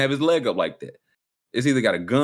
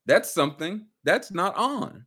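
slow, rising higher, puzzled; mock bemused; 8 semitones higher, slower than usual. Mocking his friend staring at the PlayStation, slow and baffled. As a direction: drag it out, baffled. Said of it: "So you would be like".

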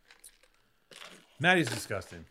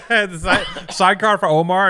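laughing, a little louder and higher; 5 semitones higher, 7 dB louder, slower than usual. Real, not acted. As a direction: laugh the words out. Laughing through the joke.

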